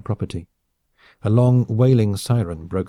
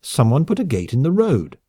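put-on indignant, raised drunken complaint; angry; 6 semitones higher, 4 dB louder than usual. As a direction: insist loudly someone else is to blame. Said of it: "the woman answered angrily".

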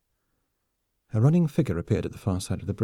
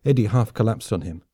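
plain reading voice

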